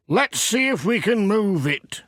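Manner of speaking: cheerful workman warning the postman back; Peter's voice. Acted. Farmer telling Pat to stand back, high and hearty. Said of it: "he said".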